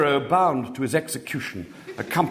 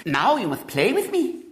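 put-on lisping little girl; 10 semitones higher than usual. A narrator's reading. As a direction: lisp it like a spoilt small girl. Little girl voiced in story.

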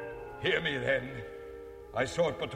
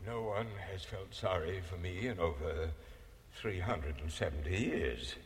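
sorrowful; 12 dB quieter than usual. Acted. Ghost's sorrowful confession.

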